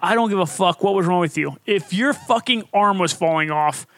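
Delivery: ordinary talk, nothing marked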